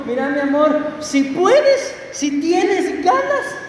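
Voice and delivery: lightly acted wife, drawn out and rising; esposa pidiendo. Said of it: "como que llegue la esposa con el esposo". (esposa que pide con rodeos)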